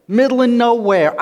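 high, loud, enraged cry; furious shout. Acted. Stranded man yelling at his idle companion, furious shout. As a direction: yell it in outrage. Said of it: "Finally, he's getting downright furious".

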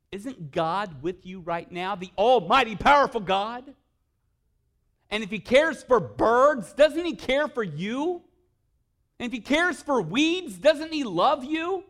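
loud, rising fervent preaching; preaching at full force. Acted. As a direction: build to a booming climax.

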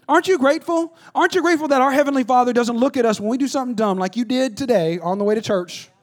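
ordinary talk, nothing marked

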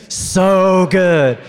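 big loud, high group shout; yelled from the gut. Real, not acted. Preacher leading the congregation's yelled response, loud. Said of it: "you guys yell out the choice".

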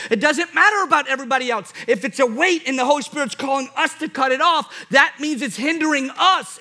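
high-pitched, insistent preacher; full-force preaching. Real, not acted.

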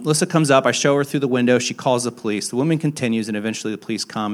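ordinary talk

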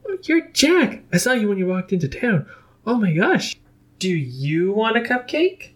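very high, gushing excitement; bubbly and breathless; excited Pinkie Pie. A narrator's reading.

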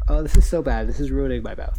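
loud appalled complaint; real disgust. Real, not acted. Taster complains of licorice to co-host, loudly disgusted.

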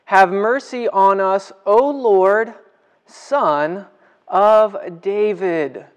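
calling out, stretching Son of David slowly; mock cry out. Blind men calling to Jesus, a mild mock cry. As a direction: cry for mercy like a blind beggar. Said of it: "what did they cry out".

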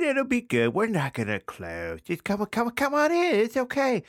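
mocking carefree official; mock chipper clerk. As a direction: brush off the danger cheerfully.

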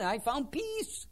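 slow, high, soft self-satisfied sigh; a hint of a pleased lunch speaker; mock smug businessman. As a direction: lightly mimic the contented speaker. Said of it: "he found peace even".

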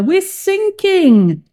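high, loud, long-drawn alarm; crying out. A narrator's reading. Sailor on a sinking boat, crying out in alarm. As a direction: wail it out slowly, high-pitched. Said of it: "cried a sailor".

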